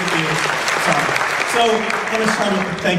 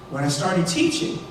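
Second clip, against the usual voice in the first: ordinary talk, nothing marked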